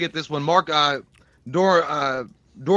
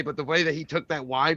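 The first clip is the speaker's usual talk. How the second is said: usual speaking voice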